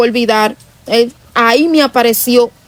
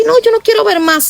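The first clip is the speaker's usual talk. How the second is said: panicked plea; 11 semitones higher than usual. Retold or imitated. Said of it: "yo hacía así".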